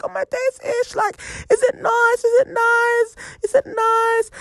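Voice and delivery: mocking friends. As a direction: tease her through laughter like giggling friends. Friends laughing and mocking her.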